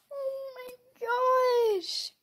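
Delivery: loud gasp then very slow high awe; shocked gasp. Real, not acted. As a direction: gasp and stretch it out.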